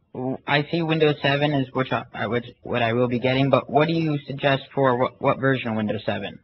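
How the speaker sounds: ordinary talk, nothing marked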